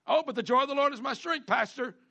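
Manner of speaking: loud, fast mock whine of a complaining churchgoer; mock complainer. Mimicking a complaining churchgoer to the pastor, loud and fast. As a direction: ham up a churchgoer brushing the pastor off. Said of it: "they're complaining and moaning".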